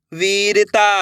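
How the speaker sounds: वीरता with वी held long. Shown as a demonstration. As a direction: stretch the ee in वीरता. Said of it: "मेरे साथ साथ इनको रिपीट करना है".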